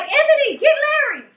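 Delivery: high-pitched urgent call, imitated; mock holler across the water; retold calling out. Mom sending oldest son to get Larry, retold urgent call. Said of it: "Yes, I'm like".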